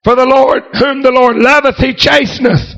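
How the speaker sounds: usual speaking voice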